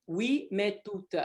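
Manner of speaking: slow Oui, mais toute as its own phrase; Oui, mais toute detached and unhurried; broken into chunks. Shown as a demonstration. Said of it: "if you break it up".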